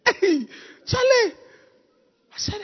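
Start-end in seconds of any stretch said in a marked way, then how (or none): 0.1-1.3 much higher, slow drawn-out call. Retold or imitated. Worker calling Charlie out of the bush, high drawn-out call. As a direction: call across the site to Charlie.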